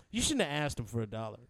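brother's remark. Retold or imitated. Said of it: "I just hear his brother say".

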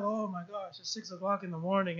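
very soft, fast, low mumble; muttering at six in the morning, barely voiced; groggy morning groan. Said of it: "I'm like".

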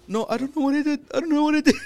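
hammed-up fearful pleading, high pitched; mock panicked dad. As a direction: whimper it like a scared father. Imitating scared dad.

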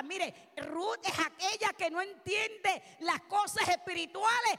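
loud, emphatic pulpit delivery that eases at the end; fervent preaching. Real, not acted. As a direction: preach it forcefully to the congregation. Preaches at full force.

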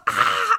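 loud high "Ah!"; sleep-talk yell. Retold or imitated. Boyfriend shouting in his sleep, a loud "Ah!". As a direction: yelp like someone in a dream. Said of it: "He was just like".

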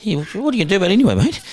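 mock challenge. Inmate daring the host over his wife, quick cheeky challenge. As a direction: rattle it off like a cocky tease. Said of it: "inmate challenging host on phone".

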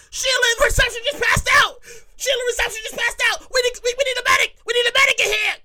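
high panicked shout for a medic; man down shout. Acted. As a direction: yell that Sheila collapsed. Coworker yells Sheila collapsed.

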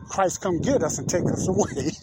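usual speaking voice

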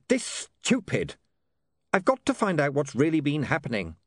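high-pitched, halting protest; lightly voiced William, dismayed. A narrator's reading. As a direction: let the son falter, then insist.

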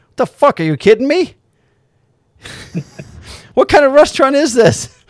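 imitating an outraged friend; pissed off. Friend fuming at the tiny breakfast menu, outraged. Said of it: "my buddy Mark was pissed".

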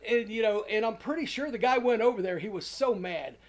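usual speaking voice

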